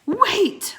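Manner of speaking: a high loud urgent call; yell. Acted. Teddy bursting in to stop the wizard, yelling. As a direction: yell it from across the room. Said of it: "someone yelled".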